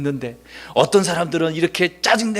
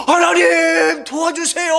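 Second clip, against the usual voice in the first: high, loud drawn-out plea; crying out in prayer; 11 semitones higher, 5 dB louder, much slower than usual. Retold or imitated. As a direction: shout to God for help, stretching it out. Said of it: "부르짖었다는 말이 뭐예요?". (크게 부르짖는 기도)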